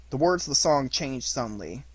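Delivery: ordinary delivery, nothing marked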